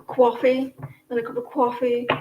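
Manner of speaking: mock New York "cawfee" vowel; Yankee accent. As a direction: say coffee like a New Yorker, "kwaw-fee". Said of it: "I love the, uh, Yankee accent".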